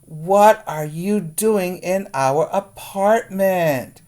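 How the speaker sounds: high, loud and slow astonished cry; loud, high, slow amazed exclaim; amazed exclamation. Acted. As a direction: exclaim it in starstruck disbelief. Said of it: "he exclaimed".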